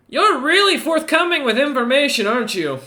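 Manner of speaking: high, loud, teasing ogre voice; ogre's teasing voice. Acted. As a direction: tease him from above. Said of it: "looks back and goes".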